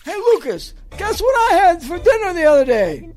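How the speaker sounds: high, loud setup for a bit; hammy blooper setup. Acted. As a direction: pitch it up like a staged outtake. Acting out a staged blooper.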